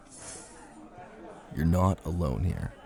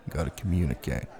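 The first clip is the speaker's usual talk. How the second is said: ordinary delivery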